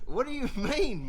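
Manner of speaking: high, loud incredulous demand; alarmed disbelief. Retold or imitated. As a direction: blurt it out in rising panic. Alarmed passenger questions plane mechanic.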